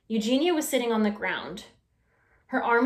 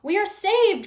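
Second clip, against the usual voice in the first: high, loud relieved cry; rescued wife crying out with joy; cried out; 10 semitones higher, 5 dB louder than usual. A narrator's reading. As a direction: burst out in relief. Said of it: "cried Missus Watson".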